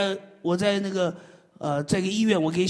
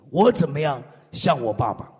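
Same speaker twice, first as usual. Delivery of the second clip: ordinary talk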